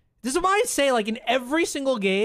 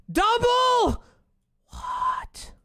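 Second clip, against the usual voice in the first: much higher, jumping up in surprise; sudden excited yelp; 14 semitones higher, much slower than usual. Real, not acted. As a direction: burst out in disbelief. Surprised at game result.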